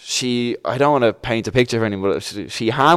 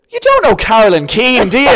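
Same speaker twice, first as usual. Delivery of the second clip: loud, high Irish mammy voice; imitated nurse, pitched up and loud; 11 semitones higher, 9 dB louder than usual. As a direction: shriek it like a delighted Irish mammy. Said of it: "this Irish mammy".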